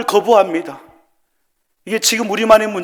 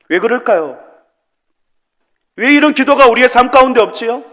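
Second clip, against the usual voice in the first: raised, impassioned pulpit appeal; fervent preaching; 8 semitones higher, 8 dB louder than usual. Acted. Pastor urging the congregation to pray: loud fervent preaching. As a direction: plead with the church at full force.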